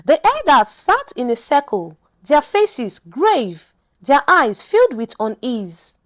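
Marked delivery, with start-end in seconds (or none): none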